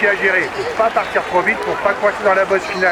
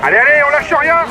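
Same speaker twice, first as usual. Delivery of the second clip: barking gym coach; 10 semitones higher than usual. Acted. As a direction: yell the rider on to the line. Team director yelling rider to finish.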